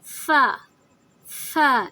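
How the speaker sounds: "فا" opened with a blown f; puffed Fa. Shown as a demonstration. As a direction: say "فا" with air, as if blowing out a candle. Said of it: "اس میں پھونک کی آواز". ("فا" ہوا اور پھونک کے ساتھ)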